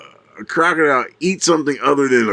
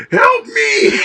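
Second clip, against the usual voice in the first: high, laughing mock shout; the hyena at the river's edge, laughing high shout; hyena crying for help; 3 dB louder than usual. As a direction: yell it up high like a drowning animal, half laughing. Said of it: "you can tell it's like".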